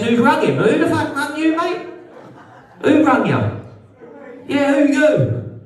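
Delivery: high, mocking jabs at a heckler. Real, not acted. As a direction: needle the man on the phone. Mocking an audience member on phone.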